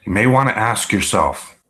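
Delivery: ordinary talk, nothing marked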